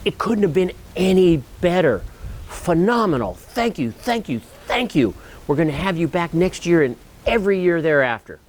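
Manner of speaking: thrilled coordinator raving; over-the-top thankful; gushing praise. Retold or imitated. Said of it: "she says".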